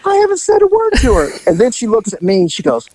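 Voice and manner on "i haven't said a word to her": high, fast whiny protest; a nervous partner pleading innocence; retold protest. Partner protesting to the storyteller, high and fast. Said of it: "Mick looked at me and goes".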